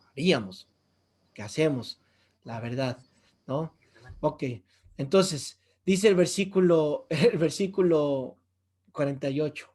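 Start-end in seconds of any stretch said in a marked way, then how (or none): none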